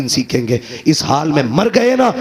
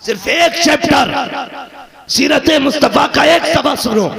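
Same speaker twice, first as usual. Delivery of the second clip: fiery preaching; 5 semitones higher than usual. Acted. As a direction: preach it to the crowd, voice raised high.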